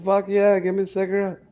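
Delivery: hammed-up eager teen; mock eager. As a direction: jump at the offer like a kid.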